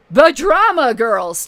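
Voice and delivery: drawn-out scornful mocking emphasis; slow, loud sneer at drama girls.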